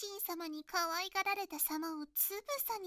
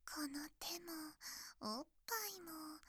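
whispering; 8 dB quieter than usual. Acted. Teasing whisper in character.

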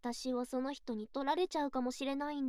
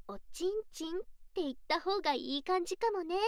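ordinary talk, nothing marked

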